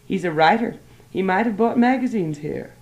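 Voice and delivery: eagerly. A narrator's reading. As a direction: rush in hopefully. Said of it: "And she said eagerly".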